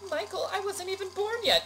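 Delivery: high-pitched mock girl's voice; squeaky imitation of a protest; imitating friend's protest. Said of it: "And you're like".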